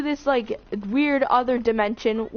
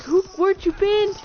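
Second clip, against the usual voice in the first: imitated frantic questioning; 9 semitones higher, 4 dB louder than usual. Friends spazzing out at her return.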